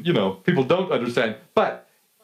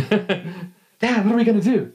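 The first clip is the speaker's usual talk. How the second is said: put-on excited kid; 8 semitones higher, slower than usual. Eleven-year-old asking his dad again, high and excited. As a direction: bounce with a child's eagerness. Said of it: "I'm all excited".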